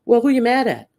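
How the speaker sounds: incredulous challenge. Real, not acted. Challenging Kimmy, rhetorical.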